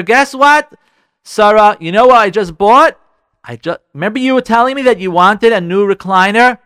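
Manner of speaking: loud, eager husband with big news; proud announcement. Acted. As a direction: announce your surprise gift proudly. Husband announces recliner surprise.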